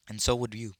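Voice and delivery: loud, miserable outburst; loud, unhappy boy who has been crying. A narrator's reading. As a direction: blurt it out, not caring who hears. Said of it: "like a boy who was so miserable".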